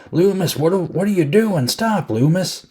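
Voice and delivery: high-pitched imitation of a flustered cop; mock alarmed protest. Brackett trying to stop Loomis, mock alarm. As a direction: plead in mock panic, pitched well up.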